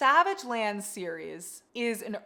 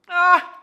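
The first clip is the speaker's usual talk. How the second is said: a loud, high anguished "ah"; pained yelp; anguished cry; 10 semitones higher, 16 dB louder than usual. Real, not acted. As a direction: cry out at the memory of the ending. Overwhelmed by a sad book ending.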